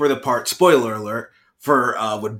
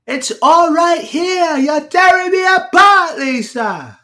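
much higher, louder and slow, hammed-up Wiseau; Tommy Wiseau impression; 7 dB louder, slower than usual. For his co-host, a high loud slow overacted Wiseau. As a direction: wail it like a torn, overwrought Tommy Wiseau. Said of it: "Tommy Wiseau's more breathy".

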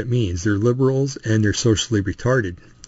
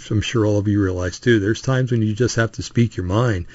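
ordinary talk

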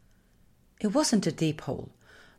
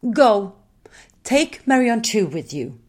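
loud, raised-pitch command; sharp order; 5 semitones higher, 7 dB louder than usual. A narrator's reading. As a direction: send them off with a forceful order. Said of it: "ordered Tiki".

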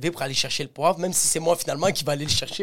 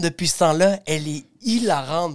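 normal talking voice

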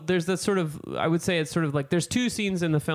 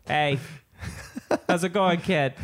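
put-on Boston accent. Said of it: "We'd be like".

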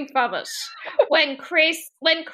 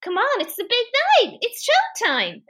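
lightly acted, higher-pitched urging; rousing someone; 9 semitones higher than usual. [name] wakes passed-out Jessie, lightly acted rousing. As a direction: imitate an eager manager rousing his star.

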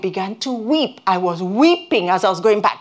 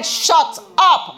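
firm rebuke; 8 semitones higher than usual. Retold or imitated. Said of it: "I said".

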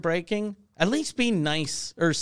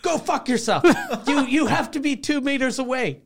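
higher, mocking outrage at the cops; mock indignant shopkeeper; 9 semitones higher than usual. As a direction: imitate her telling off police.

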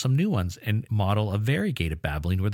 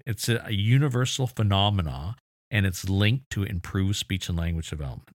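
ordinary delivery

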